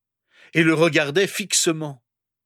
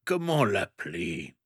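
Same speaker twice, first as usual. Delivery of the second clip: low, softer self-questioning; pondering aloud in a lowered voice; musing to himself; 6 semitones lower, 5 dB quieter than usual. A narrator's reading. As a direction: wonder it quietly to yourself. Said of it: "se demanda-t-il à lui-même".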